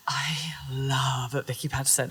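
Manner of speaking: slow, whispered declaration of love; hushed confession. Retold or imitated. Retelling a drunken call to Kay, whispering.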